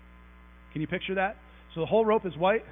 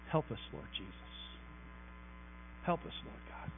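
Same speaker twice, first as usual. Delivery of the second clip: hushed prayer; 10 semitones lower, 14 dB quieter than usual. Real, not acted. Pleading prayer after hard verse.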